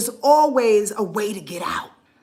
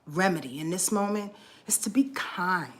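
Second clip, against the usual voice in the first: tender whisper; 3 semitones lower, 6 dB quieter than usual. Real, not acted. Tender advice, near tears.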